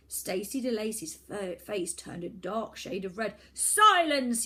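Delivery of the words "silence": high, very loud command; shouted. Acted. Boy villain shouts for silence, very loud. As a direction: bark the order. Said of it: "he shouted".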